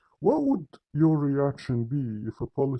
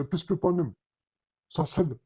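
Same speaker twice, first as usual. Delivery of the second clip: higher, rattled-off blessing, words run together; careless rush; 5 semitones higher, much faster than usual. Retold or imitated. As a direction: imitate a hasty rote formula. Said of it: "they will say it very slowly and very carefully".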